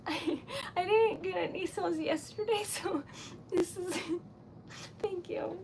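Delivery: real happy overwhelm, voice giving out; choked up. Real, not acted. Overwhelmed by gratitude for sale.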